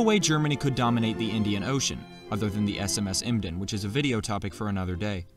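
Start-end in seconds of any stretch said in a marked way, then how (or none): none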